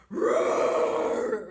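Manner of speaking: loud breathy roar to scare a bear; mock scary roar. Wife behind husband scaring bear, mock roar. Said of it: "try to be big and scary".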